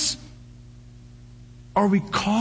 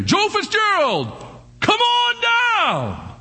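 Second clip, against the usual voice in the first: game show announcer; 11 semitones higher, much slower than usual. Retold or imitated. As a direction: call the contestant like a TV host. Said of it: "They'd say".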